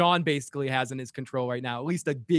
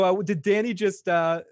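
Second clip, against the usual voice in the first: ordinary talk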